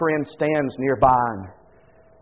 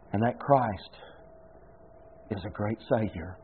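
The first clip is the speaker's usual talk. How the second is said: softer, fading last words; feeble whisper; 2 semitones lower, 8 dB quieter than usual. Retold or imitated. Dying John Newton to his friend, soft fading voice. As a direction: let the words die away, faint and weak. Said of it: "This came in a feeble whisper".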